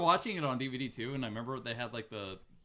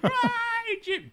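lightly voiced cartoon kid. Imitating Carl from the show.

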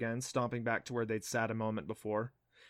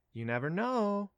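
casual; 8 semitones higher than usual. Acted. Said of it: "she said casually".

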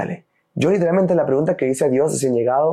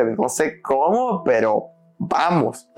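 slow resolve; much slower than usual. Retold or imitated. Retold resigned prayer.

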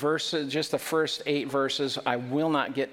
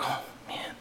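whispered sigh; 8 dB quieter than usual. Pretend. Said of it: "It's like".

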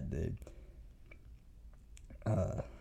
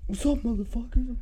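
high, louder cocky greeting; imitated swagger; 5 dB louder than usual. Eli arriving with a machete, a high cocky mock greeting. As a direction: pull up and greet them like a tough guy. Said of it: "he's like".

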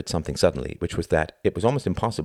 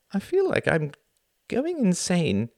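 high, drawn-out desperation; desperate frustration; 13 semitones higher, slower than usual. Acted. Husband tells wife he is going insane, desperate. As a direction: stretch it out at wit's end. Acted husband at breaking point.

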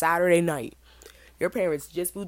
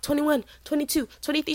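teasing; 9 semitones higher than usual. Retold or imitated. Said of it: "I'm like".

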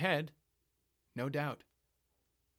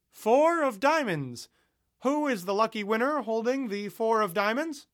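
announcer call; 9 semitones higher, 7 dB louder than usual. Acted. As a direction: announce the winning card to the crowd. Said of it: "until he announced".

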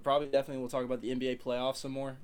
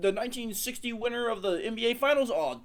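higher, louder quiz-host voice; game show voice; 10 semitones higher, 9 dB louder than usual. Pretend. Mock quiz answer, show voice.

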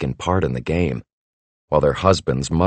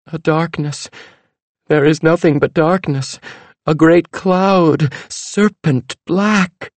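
high, very slow dread-filled voice; fortune teller; 12 semitones higher, slower than usual. A narrator's reading. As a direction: draw out the prophecy ominously. Fortune teller prophesying doom.

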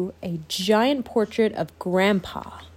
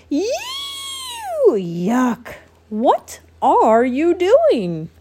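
disgusted mole rats; 9 semitones higher, 4 dB louder, much slower than usual. Acted. Mole rats mocking Wilbur.

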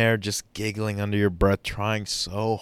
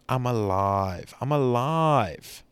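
slow, climbing higher with each repeat; slow rising cry of being alive; building scream; 5 semitones higher, slower than usual. Shown as a demonstration. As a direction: start steady, then lift it up. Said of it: "I want you to scream".

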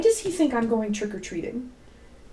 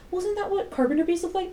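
coworker impression; 9 semitones higher than usual. Voicing Miss J's doubtful question.

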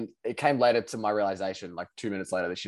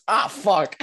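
higher, louder mock cry of pain; imitated pained yelp; 9 semitones higher, 6 dB louder than usual. Sam imitating a shot paintball opponent yelping in pain. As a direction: yelp as if hit in the leg. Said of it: "and he goes".

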